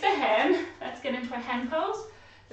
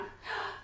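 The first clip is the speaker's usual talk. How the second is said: shocked gasp; 9 semitones higher, 11 dB quieter than usual. Acted. Shocked reaction.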